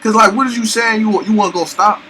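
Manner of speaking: loud, high-pitched disbelief; shocked and loud, demanding an answer; talking too loud. Real, not acted. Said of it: "I know I talk too loud".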